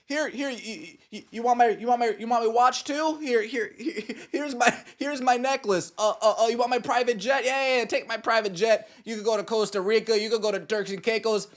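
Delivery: high, flustered mock giveaway; hammed-up eager owner handing everything over; mock fawning owners. Owners mocking players by handing over valuables, high flustered mock voice. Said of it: "the owners are saying".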